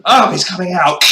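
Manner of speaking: loud, high hammed-up alarm; mock panic. Player joking about chest pain, mock panic. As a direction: cry out as if in agony, hammed up. Alien chest burster joke.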